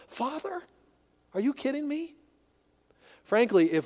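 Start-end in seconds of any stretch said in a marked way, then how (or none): 0.1-2.1 high, hushed mock disbelief; mock a shocked disciple, pitched way up; lightly voiced scandalized disciple; incredulous disciple. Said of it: "even to his own disciples".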